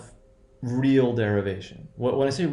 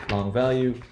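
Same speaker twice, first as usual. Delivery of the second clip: ordinary talk, nothing marked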